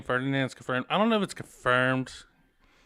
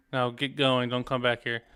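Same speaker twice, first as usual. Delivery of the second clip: ordinary talk, nothing marked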